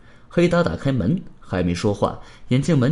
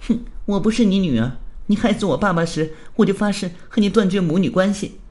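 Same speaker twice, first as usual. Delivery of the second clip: higher-pitched, cold accusing voice; 6 semitones higher than usual. Acted. Thief daughter disowning her mother, cold. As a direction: disown her with icy resolve. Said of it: "小偷女则冷冷地说道".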